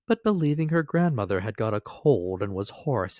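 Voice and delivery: ordinary delivery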